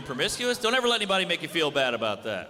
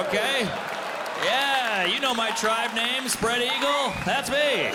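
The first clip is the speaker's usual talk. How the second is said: higher-pitched, swaggering comic brag; brazen, boastful tribeswoman; 5 semitones higher than usual. Acted. Tribeswoman dodging sacrifice by the chief, brazen boasting.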